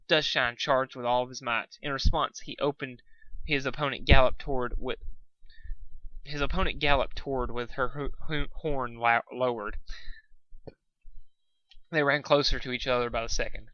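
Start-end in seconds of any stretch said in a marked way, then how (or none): none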